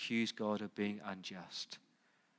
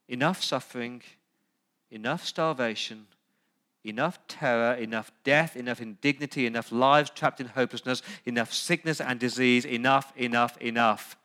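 a litany of enough, rising louder line by line; building preaching; 7 dB louder than usual. Acted. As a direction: hammer each enough as the list builds.

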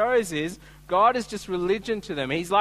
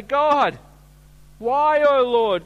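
anguished cry; 6 semitones higher, 7 dB louder, slower than usual. Pretend. Israelites crying out to God.

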